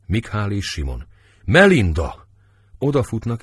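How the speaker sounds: ordinary delivery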